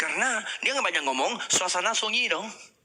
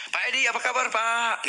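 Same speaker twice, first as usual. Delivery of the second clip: brisk greeting to boss; 9 semitones higher than usual. Shown as a demonstration. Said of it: "tipe pemimpin".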